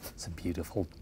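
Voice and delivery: put-on hushed, low Australian accent; barely-there Australian, near a whisper; quiet Australian accent. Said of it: "do my Australian accent".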